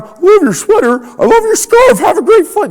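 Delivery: beam at strangers in a hammed-up sing-song; mock-sunny greeter showering compliments; over-the-top cheerful greeting. Acting out his airport greetings.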